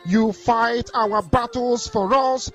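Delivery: ordinary delivery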